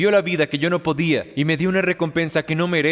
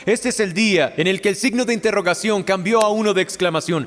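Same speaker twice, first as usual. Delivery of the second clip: rising, impassioned preaching, slightly higher; building altar call; 5 semitones higher than usual. Acted. Pastor urging listeners to be born again, with rising fervor. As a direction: build the appeal with growing fervor.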